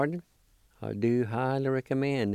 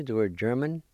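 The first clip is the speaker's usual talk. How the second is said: normal talking voice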